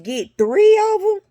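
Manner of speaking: squeaky high, loud disbelieving question; incredulous question. Retold or imitated. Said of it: "I said".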